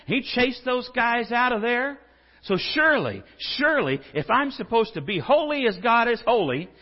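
fiery sermon build-up; full-force preaching. Acted. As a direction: preach it hard, voice rising loud and high. Preaching climax on anger.

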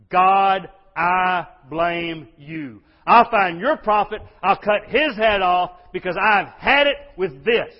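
enraged shout at God. Acted. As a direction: bellow it like a king who's had enough. Enraged king blames God.

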